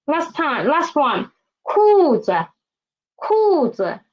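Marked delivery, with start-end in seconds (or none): 1.7-4.0 裤子 with falling 裤, low neutral 子; teacher's model 裤子, high fall into light low 子; neutral tone lower. Shown as a demonstration. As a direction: drop sharply on 裤, land low on 子. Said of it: "the neutral tone is lower".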